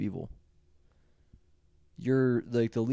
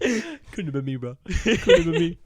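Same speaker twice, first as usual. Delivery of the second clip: mock Kevin denial; 3 semitones higher, 4 dB louder than usual. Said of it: "quoting Kevin Smith's denial".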